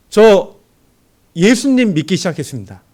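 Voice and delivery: loud, high confession of faith; boldly telling the boss at a drinking party, loudly; bold declaration. Retold or imitated. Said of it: "담대하게 얘기했어요".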